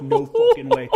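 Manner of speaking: a squeaky, laughing no way; stunned disbelief. Retold or imitated. Shocked teller hearing the marine fail again, laughing squeal. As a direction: pitch shoots way up in laughing shock.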